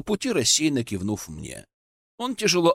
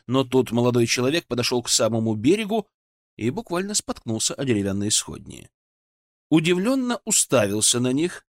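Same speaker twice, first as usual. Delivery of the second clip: usual speaking voice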